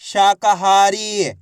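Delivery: शाकाहारी very slow, every vowel long; syllables blended slowly. Shown as a demonstration. Teacher answering what the letters make, शाकाहारी drawn out. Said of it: "तो बताइए मिलके क्या बनेगा".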